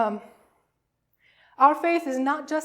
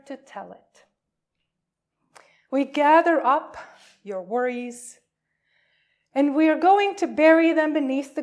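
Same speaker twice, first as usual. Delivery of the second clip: louder, uplifted call to worship; liturgical reading; 7 dB louder than usual. Acted. Minister calls the congregation to Easter worship, louder and uplifted. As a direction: proclaim it to the congregation like an Easter blessing.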